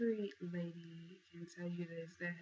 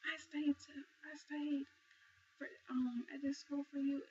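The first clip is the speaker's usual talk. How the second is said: retold angry yell; 8 semitones higher, 3 dB louder than usual. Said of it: "He's really loud".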